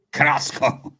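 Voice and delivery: Carrasco with a rolled, hammed-up Spanish accent; half-joking Spanish-accent take on Carrasco; mock Spanish accent. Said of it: "never really had a good Carrasco bit".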